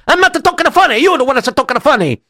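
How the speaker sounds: high, hammed-up Italian accent; funny Italian accent. Local answers the visiting rabbi, comic Italian accent. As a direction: babble in a cartoon Italian accent. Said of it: "you could stop talking funny".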